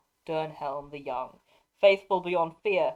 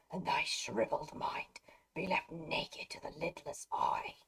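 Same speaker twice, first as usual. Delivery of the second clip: creepy wraith whisper; 5 dB quieter than usual. Acted. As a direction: breathe the threat like a ghost. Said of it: "when I talk like that".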